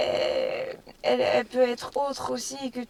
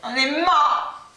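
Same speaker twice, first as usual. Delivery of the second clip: dramatic doom; 5 semitones higher, 6 dB louder, much slower than usual. Acted. Dramatic slow loud.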